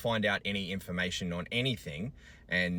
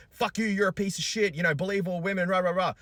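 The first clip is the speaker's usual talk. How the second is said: high, loud shrill mimic of hostile women; mocking commenters; 12 semitones higher, 7 dB louder than usual. Father mocking hostile women commenters, loud high sneer. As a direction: sneer their chant back mockingly. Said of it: "females ... that were like".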